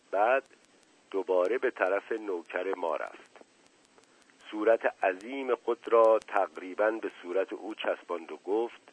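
ordinary delivery

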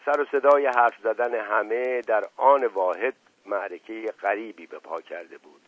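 plain reading voice

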